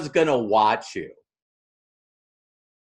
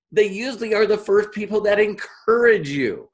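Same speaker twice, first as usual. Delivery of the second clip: usual speaking voice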